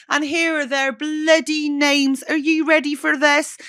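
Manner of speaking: high, playful announcer-style thank-you; put-on voice. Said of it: "I don't know why I'm doing that voice".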